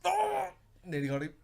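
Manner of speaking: high excited yelp. Real, not acted. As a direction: squeal it with glee. Excited near-guess.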